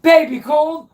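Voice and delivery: put-on high, loud "Baby, you cold?"; loud mock question. Sleepwalker throwing blankets at his wife, loud mock question. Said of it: "and go".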